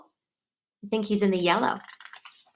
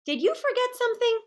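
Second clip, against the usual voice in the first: teasing; 13 semitones higher, 5 dB quieter, faster than usual. Acted. Max teasing Froggy.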